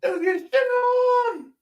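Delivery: drawn-out falsetto holler; silly high shout. Pretend. Heckling the host's serious question, silly falsetto shout. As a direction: holler it high and slow for laughs.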